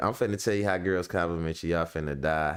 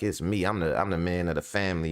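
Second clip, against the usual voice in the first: ordinary delivery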